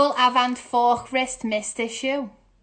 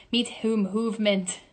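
usual speaking voice

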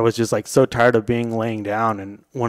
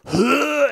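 high-pitched mock heave; imitated retching; 14 semitones higher than usual. Patient about to puke in the ambulance, imitated retching. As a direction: act out vomit suddenly coming up.

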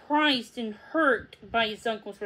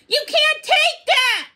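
much higher, loud cry of protest; 8 dB louder than usual. A narrator's reading. Puppy protesting as Jeb takes the orb, high loud cry. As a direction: cry out to stop him. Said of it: "Charity protested".

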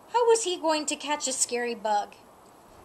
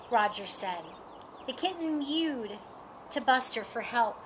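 plain reading voice

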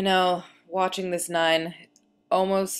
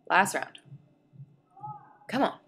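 ordinary talk, nothing marked

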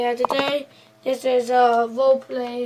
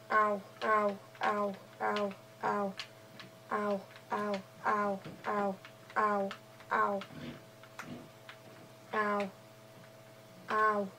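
pained ows; 3 semitones lower, 9 dB quieter than usual. Acted. Pushed into ravine, hitting head.

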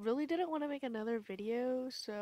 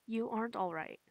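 ordinary talk

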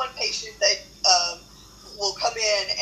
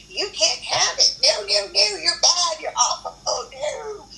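high, slow, chiding nags; mock harsh self-critic; scolding mock voice; 9 semitones higher than usual. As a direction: wag a finger at yourself. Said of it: "and not this".

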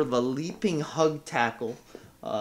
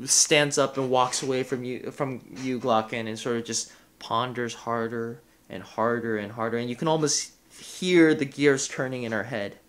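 ordinary delivery

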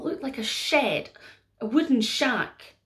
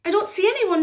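puzzled Mary; 9 semitones higher, 6 dB louder, faster than usual. Acted. Mary confused.

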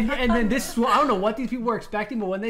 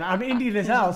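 usual speaking voice

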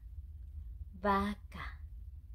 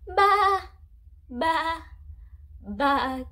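loud bleating baa; sheep bleat imitation; 3 semitones higher, 8 dB louder, much slower than usual. Shown as a demonstration. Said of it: "Let's do it together".

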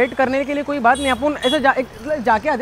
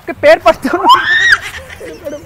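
pleading shout; 5 semitones higher than usual. Pretend. Begging woman to come along.

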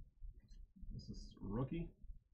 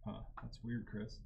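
ordinary talk

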